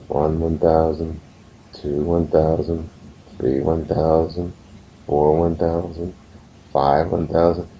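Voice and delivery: slow, evenly spaced counting. Retold or imitated. Father counting slowly for his son during a hug, slow count. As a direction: count out each second unhurriedly. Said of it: "count really slow".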